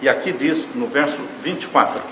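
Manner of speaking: ordinary talk, nothing marked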